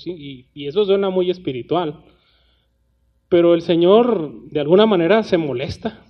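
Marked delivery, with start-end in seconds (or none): none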